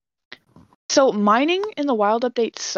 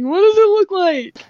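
confused listener voice; 10 semitones higher, 6 dB louder than usual. Retold or imitated. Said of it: "listeners who are just like".

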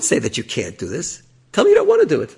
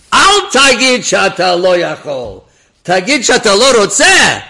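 slow, loud, higher, every word pressed; firm rebuke; 10 semitones higher, 14 dB louder, slower than usual. Retold or imitated. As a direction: lay down the correction deliberately. Rabbi rebuking in Hebrew.

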